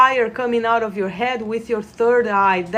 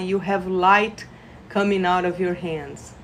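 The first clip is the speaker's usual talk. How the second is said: normal talking voice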